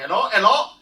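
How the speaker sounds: loud, high-pitched imitation Greek accent; thick Greek accent. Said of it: "this really thick Greek accent".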